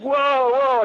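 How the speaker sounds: high-pitched mock freak-out; imitated freak-out. Actor inside the life cast as silicone hits his hand, mock freak-out. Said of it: "inside the thing freaking out".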